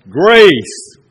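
loud exclamation of Grace; a single loud, ringing Grace; exclaimed. Acted. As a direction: hit the word Grace out loud. Preacher exclaims scripture word.

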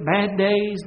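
ordinary talk

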